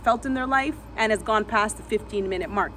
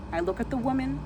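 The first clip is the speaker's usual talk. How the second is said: usual speaking voice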